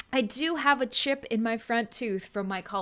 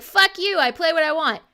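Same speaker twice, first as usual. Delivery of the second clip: loud, higher mock-defiant retort; defiant snap; 5 semitones higher, 6 dB louder than usual. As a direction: bark it back, playfully rude. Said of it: "I'll be like".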